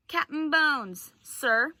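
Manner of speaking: loud, slow call through the window; boy calling up to the pirate captain, loud and slow; shouting. A narrator's reading. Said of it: "he shouted".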